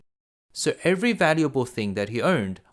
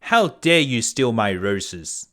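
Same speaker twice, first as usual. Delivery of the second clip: louder, booming beast scolding; 5 dB louder, slower than usual. A narrator's reading. Beast catching the merchant stealing his rose, loud and booming. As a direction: boom down at the thief like a beast. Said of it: "said the beast loudly".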